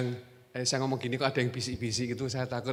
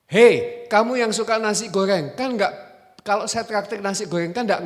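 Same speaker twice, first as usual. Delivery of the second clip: sudden loud "Hei", higher pitched challenge; loud call-out; 7 semitones higher, 9 dB louder than usual. Acted. Preacher calls out the nasi goreng lover, loud challenge. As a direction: call across the room to catch him.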